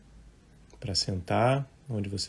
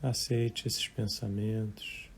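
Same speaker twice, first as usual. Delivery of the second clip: guided meditation; 9 dB quieter than usual. Real, not acted. Meditation teacher leading live listeners through breathing and thoughts, calm and unhurried. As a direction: guide listeners gently, leaving long silences between short phrases.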